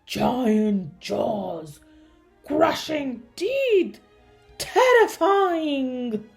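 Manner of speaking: loud, slow, mounting horror; drawn-out theatrical terror; dramatic dread. A narrator's reading. Dentist lists what scares him.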